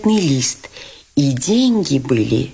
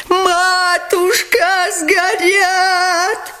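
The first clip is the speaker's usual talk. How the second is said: much higher, slow, drawn-out wail; 14 semitones higher, much slower than usual. Acted. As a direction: wail in despair over the money. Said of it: "вопил Лебедев". (протяжный высокий вопль)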